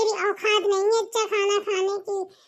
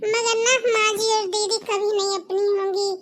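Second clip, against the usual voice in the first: ordinary talk, nothing marked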